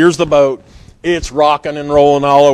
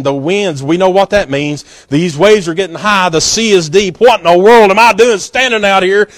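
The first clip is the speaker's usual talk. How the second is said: Peter's fearful thoughts; 4 semitones higher, 6 dB louder than usual. Retold or imitated. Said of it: "He starts thinking".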